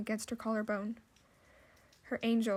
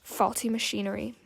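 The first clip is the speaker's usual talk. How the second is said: ordinary delivery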